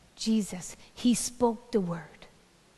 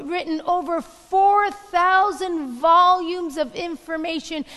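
rising louder and higher with each call; building sermon refrain; 7 semitones higher, 7 dB louder than usual. Acted. Preacher building a call and response to full force.

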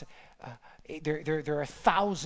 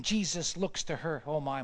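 ordinary talk, nothing marked

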